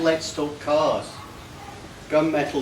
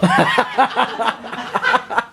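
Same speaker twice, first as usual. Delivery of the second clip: high, loud laugh; 9 semitones higher, 4 dB louder than usual. Real, not acted. Speaker reading to the guests, laughing at the names. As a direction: laugh at your own rhyme.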